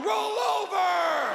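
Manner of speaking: high, drawn-out howled shout; exasperated dog. Acted. Dog outside shouting at the snorer, exasperated howl. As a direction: howl it out long and loud. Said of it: "Even the dog's outside going".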